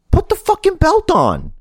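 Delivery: very high, strained-pitch plea; indignant, voice way up; exasperated demand. Real, not acted. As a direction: order it in squeaky exasperation.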